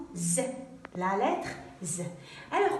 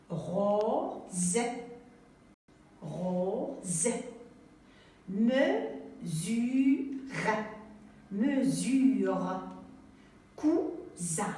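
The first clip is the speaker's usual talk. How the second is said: slow model reading; 2 semitones lower, much slower than usual. Shown as a demonstration. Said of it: "j'entends le son Z".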